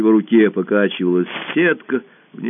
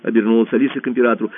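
plain reading voice

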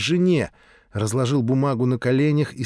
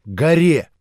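usual speaking voice

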